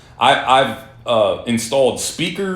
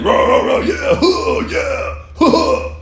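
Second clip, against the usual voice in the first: high, louder hammed-up caveman grunts; mocking guys who think cars make them manly; mock macho grunting; 3 dB louder, slower than usual. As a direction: rah rah chest-thumping parody. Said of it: "cars are manly, engines are manly".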